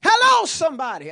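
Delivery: loud preacher's call. Real, not acted. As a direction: shout it to the room. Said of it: "I'm not yelling at you".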